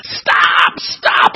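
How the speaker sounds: loud, strained scream; acted desperate shout; crying out. A narrator's reading. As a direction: scream against the worse heat. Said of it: "I cried".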